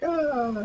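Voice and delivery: high, restrained pretend scream; high, falling mock cry of fright; mock scared yell. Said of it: "like, yelling".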